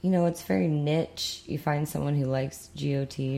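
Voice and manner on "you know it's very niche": normal talking voice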